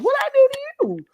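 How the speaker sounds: very high, quick protest; mock innocence. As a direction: squeak it out, wrongly accused. Her startled reply to reader.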